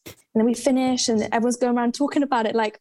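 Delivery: ordinary delivery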